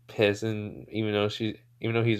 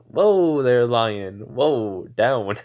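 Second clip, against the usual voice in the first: mock calming call; 6 dB louder, much slower than usual. He catches her thrown fist, loud mock calming. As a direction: call down an attacker as if taming a beast.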